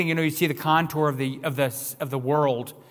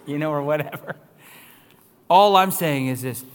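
usual speaking voice

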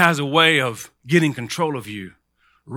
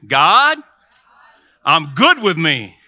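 loud call-out with a pause after God; rallying call; 7 dB louder, much slower than usual. Shown as a demonstration. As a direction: lead the crowd, slow and loud. Leads congregation in call-and-response.

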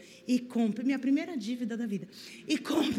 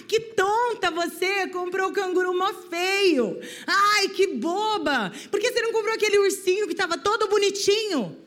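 deboche; 5 semitones higher, 3 dB louder than usual. Retold or imitated. Homem ridiculariza a criança.

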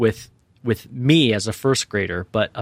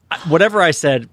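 ordinary delivery

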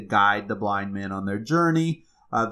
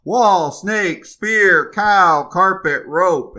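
building shouts, higher and louder on each word; six men yelling over each other; shouting louder and louder; 7 semitones higher, 8 dB louder, slower than usual. Acted. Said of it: "their shouts got louder and louder".

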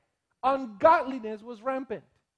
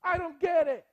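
raised, anguished questioning; distressed couple pleading; 6 semitones higher, 4 dB louder than usual. Retold or imitated. Couple in pastor's office, anguished pleading. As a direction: voice a grieving couple's why, pleading. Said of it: "they say".

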